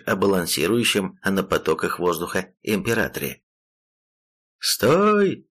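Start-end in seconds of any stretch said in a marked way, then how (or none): 4.6-5.5 sharp high yelled command; enemy spotted shout. Acted. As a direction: bark "Стой!" to halt him. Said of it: "крикнул противник".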